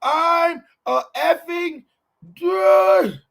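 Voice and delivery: high, drawn-out mock yell; hammed-up shout. Host retelling the woman's outburst, hammed-up shout. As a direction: stretch it into an over-the-top high holler. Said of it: "she told her".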